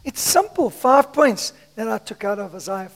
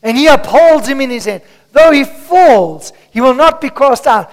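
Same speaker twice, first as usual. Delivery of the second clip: normal talking voice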